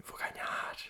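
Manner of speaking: whispered secret boast; unvoiced whisper of a confident aside. Real, not acted. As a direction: breathe it out conspiratorially.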